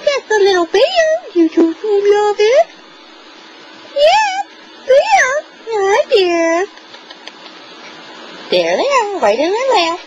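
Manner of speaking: high squeaky voice praising a dog. Real, not acted. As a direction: coo at the puppy, very high and bright. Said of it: "the top baby talk to my puppy".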